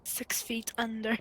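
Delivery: slow spooky whisper. Pretend. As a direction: breathe it like a graveside secret. Spooky joke about burial.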